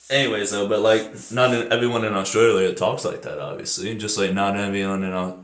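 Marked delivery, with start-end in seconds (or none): none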